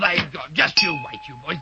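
put-on low threat to the boys; muttered threat. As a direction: drop the pitch low and threaten. Teacher threatens departed boys.

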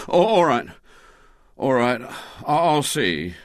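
stammering, reluctant surrender; William, capitulating. A narrator's reading. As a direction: back down hastily, flustered. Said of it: "he capitulated".

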